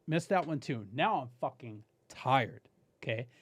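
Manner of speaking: ordinary delivery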